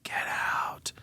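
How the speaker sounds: breathy spooky whispered warning; ghostly whisper. Retold or imitated. Ghost on a recording warns intruders, eerie whisper. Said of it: "the recordings of".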